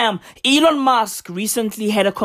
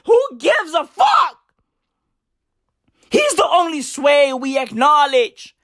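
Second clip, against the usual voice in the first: shout; 3 semitones higher than usual. Real, not acted. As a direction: shout it like a hype man. Shouted declaration.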